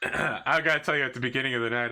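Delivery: ordinary talk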